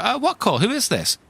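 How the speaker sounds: high, puzzled questions; confused. Retold or imitated. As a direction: sound baffled on the phone. Confused answer on phone.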